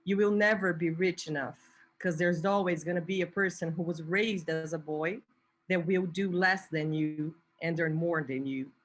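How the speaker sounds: normal talking voice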